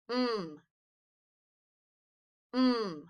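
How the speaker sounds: M hummed as "mmm"; letter sound. Shown as a demonstration. Teacher making the sound with the child, M as "mmm". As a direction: hold M as a hum on closed lips. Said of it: "Can you make the M sound".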